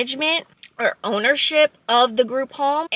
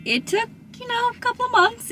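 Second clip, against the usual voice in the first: much higher, airy exasperation; exasperated; 12 semitones higher, 4 dB quieter than usual. Real, not acted. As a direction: sigh it out in exasperation. Exasperated aside about waiting.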